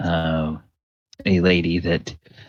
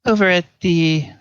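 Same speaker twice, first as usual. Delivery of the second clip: much higher, chatty country wife; farm wife voice; 12 semitones higher than usual. Acted. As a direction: prattle sweetly like a farm wife.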